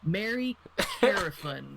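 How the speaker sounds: slow, drawn-out fake English name; mock anglicized name. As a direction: stretch it out like a clumsy dub. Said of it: "change it to be like".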